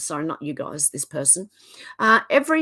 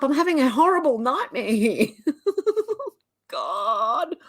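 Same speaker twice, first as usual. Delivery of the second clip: higher, laugh breaking through the words; despairing laugh; 5 semitones higher than usual. Real, not acted. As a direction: laugh helplessly at the madness.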